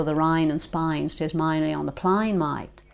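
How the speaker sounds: Australian vowels, A turned to I; broad Australian "rine in Spine", dropping on mate; Australian accent. Shown as a demonstration. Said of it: "it goes from A to I in Australia".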